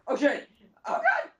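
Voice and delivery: high shrieking panic; panicked scream. Real, not acted. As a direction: scream in sudden alarm. Panic during firefight.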